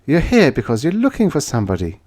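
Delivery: a knowing woman sizing him up; woman's voice. Acted.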